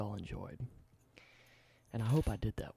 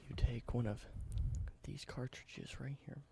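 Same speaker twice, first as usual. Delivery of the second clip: soft, close whispered ASMR roleplay; 10 dB quieter than usual. Acted. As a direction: whisper it gently like showing an old relic. Whispered ASMR show-and-tell roleplay.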